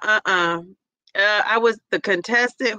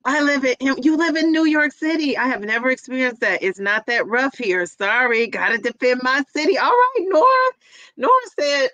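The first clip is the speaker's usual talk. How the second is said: ordinary talk, nothing marked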